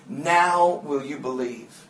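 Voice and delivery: low, soft, slow voice within; inner voice. Retold or imitated. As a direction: speak as a quiet divine prompting. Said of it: "I heard something inside say".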